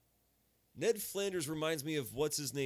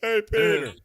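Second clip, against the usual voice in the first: high, loud greeting; cartoon impression; 6 semitones higher, 11 dB louder than usual. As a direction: belt it like a cartoon neighbour. Family Guy voice impression.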